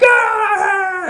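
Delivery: very high, loud startled yell; old man's shouted fright; mock startled yell. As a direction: jump out of your skin up high. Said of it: "and he's like".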